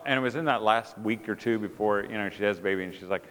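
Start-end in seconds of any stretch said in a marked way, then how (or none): none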